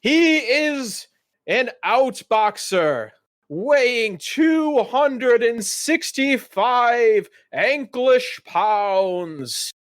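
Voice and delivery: high, slow fight-night announcing; dwarf announcer introducing Bao Lee; ring announcer intro. Acted. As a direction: drag out the fighter's name for the crowd.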